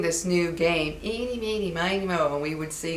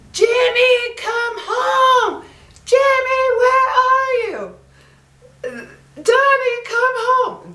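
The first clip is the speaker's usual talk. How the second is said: high, loud mother's call across the neighborhood; long high yell for the kids to come home; 7 dB louder, slower than usual. Retold or imitated. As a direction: shout it out like a mom at the door at dusk. Mothers calling kids home at night.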